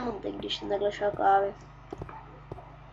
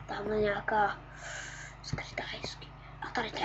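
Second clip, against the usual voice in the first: whisper; 2 semitones lower, 11 dB quieter than usual. Real, not acted. Whispering about hidden cave.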